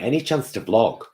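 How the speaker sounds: normal talking voice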